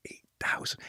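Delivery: hushed, voiceless disbelief; stunned whisper. Retold or imitated. Consultant hearing the college's speaking fee, stunned whisper. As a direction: whisper it as if floored by the sum.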